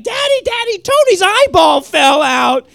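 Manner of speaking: put-on very high, loud child alarm; little sister. Six-year-old running to her dad, high and frantic. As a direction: shriek it like a frantic little girl.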